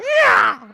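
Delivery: loud, high cat screech; imitated cat yowl. Imitating the cat kicked in its bag, loud yowl. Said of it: "You hear".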